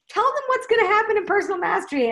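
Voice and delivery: quoted question. Retold or imitated. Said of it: "my question to the cards were".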